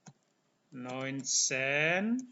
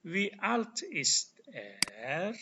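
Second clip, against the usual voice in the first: very slow with a rise on "alt"; slow teacher demo question; slow model question; 5 semitones higher, much slower than usual. Shown as a demonstration. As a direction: model "Wie alt ist er?" slowly. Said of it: "Tambah kata apa di sini?".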